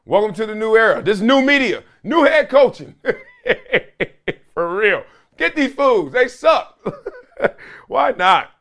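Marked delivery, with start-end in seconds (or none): 0.0-2.9 cocky coach voice. Pretend. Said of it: "just said". 5.4-6.8 cocky trash talk. Pretend. Mocking a boastful coach.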